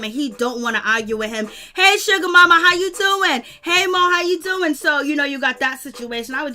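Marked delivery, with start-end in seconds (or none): 1.8-4.7 loud, high-pitched mock greeting; acted sweet-talk greeting. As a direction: imitate a man hailing his woman. Voices the nervous man greeting Toni.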